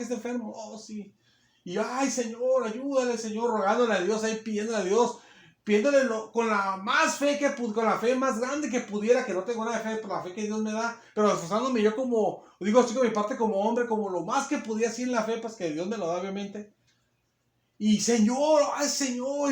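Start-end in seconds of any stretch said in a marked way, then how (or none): none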